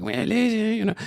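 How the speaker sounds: squeaky accented impression; Consuelo. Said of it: "a little like Consuelo almost".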